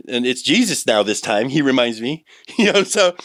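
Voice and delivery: usual speaking voice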